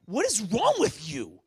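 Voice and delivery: exasperated scolding question; rebuke. Acted. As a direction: rebuke someone in disbelief. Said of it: "in the form of rebuke".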